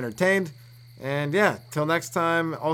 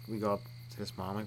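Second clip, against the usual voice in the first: usual speaking voice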